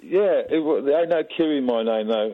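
a put-on Cockney accent. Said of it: "you're talking in a Cockney accent".

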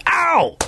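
high-pitched, sudden mock "ow"; acted pained yelp. Host acts out a shopper hit by a trolley, mock pained yelp. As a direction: yelp as if bumped from behind, played up.

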